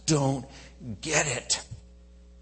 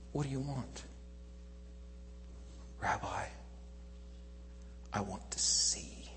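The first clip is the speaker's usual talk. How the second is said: soft, near-whispered acted exchange; hushed tenderness; 12 dB quieter than usual. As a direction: drop almost to a whisper, with pauses. Tender exchange before the healing.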